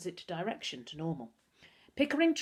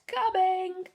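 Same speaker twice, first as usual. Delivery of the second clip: much higher, loud call down the stairs; bellowed; 13 semitones higher, 6 dB louder than usual. A narrator's reading. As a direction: bellow it down to the front door. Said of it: "and then bellowed down the stairs".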